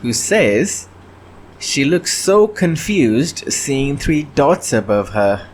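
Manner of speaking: ordinary delivery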